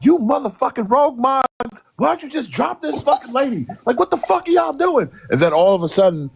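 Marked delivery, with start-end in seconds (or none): 0.0-5.1 retold angry yell. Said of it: "I came up like".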